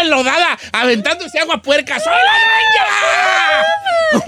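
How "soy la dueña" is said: very high, loud and slowly stretched shout; drawn-out telenovela shriek. Pretend. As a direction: howl it like a soap heroine soaked in dirty water. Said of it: "así grita".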